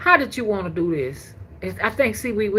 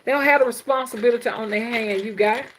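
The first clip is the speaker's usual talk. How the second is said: imitating Mister Will; 6 semitones higher than usual. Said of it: "he like, like saying".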